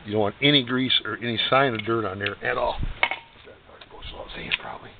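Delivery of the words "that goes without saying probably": quick whisper; half-whispered throwaway line; whispered aside. Real, not acted. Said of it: "whispered aside".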